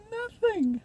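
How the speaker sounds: very high squeezed word, near crying; hysterical despair. Acted. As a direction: squeal it out between laughing and crying. Hysterical end of soliloquy.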